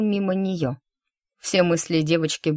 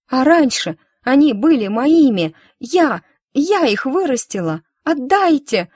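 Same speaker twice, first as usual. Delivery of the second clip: put-on high, louder child begging near tears; girl pleading; 7 semitones higher, 6 dB louder than usual. A narrator's reading. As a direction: beg like a desperate little girl. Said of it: "Яра заплакала".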